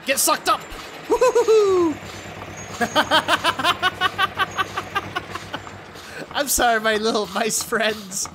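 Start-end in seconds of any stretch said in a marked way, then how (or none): 0.1-1.9 high, loud gleeful cheer; gleeful whoop. Real, not acted. Gleeful while sucking up mice. 2.0-6.2 high giggling laugh; gleeful laugh. Real, not acted. Laughs after sucking mice.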